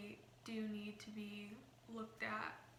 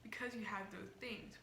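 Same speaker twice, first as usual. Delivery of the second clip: ordinary talk, nothing marked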